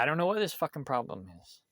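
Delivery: normal talking voice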